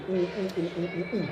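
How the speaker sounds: very soft hummed smooching; mock kissing hums. Preacher mimics his wife's kisses with soft, playful hums. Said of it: "Lalu dia cium".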